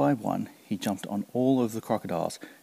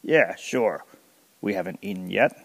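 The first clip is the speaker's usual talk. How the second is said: big crocodile with a sly smile, teasing; 4 dB louder than usual. Acted. Crocodile warning Kancil he could be eaten, sly and lightly voiced.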